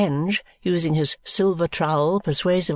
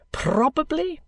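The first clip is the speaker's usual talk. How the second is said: high, rising, airy answer; pompous lawyer; 9 semitones higher, slower than usual. Acted. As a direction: let it soar upward grandly. Kenge's answer, echoed by Vholes.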